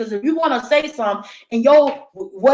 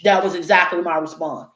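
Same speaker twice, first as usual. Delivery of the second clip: normal talking voice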